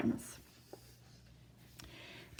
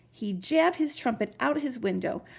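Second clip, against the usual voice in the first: ordinary delivery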